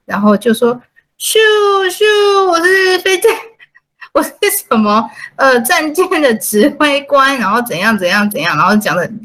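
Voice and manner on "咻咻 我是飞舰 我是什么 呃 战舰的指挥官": put-on whooshing playful child, pitched up; kid playing spaceship. A child playing spaceship commander, hammed-up kid voice. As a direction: play pretend like a little kid with a toy ship. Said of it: "一个小朋友拿一个飞船".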